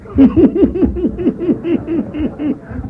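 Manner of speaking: high, hearty imitated laugh; imitating the speaker's hearty laugh to the room; imitated big laugh. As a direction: let out the speaker's booming laugh. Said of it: "he went".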